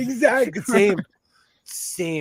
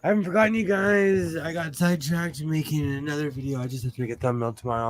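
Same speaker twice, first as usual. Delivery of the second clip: usual speaking voice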